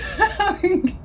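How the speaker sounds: high breathless giggle; breathless laugh. Real, not acted. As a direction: laugh out, winded. Laughs at being winded.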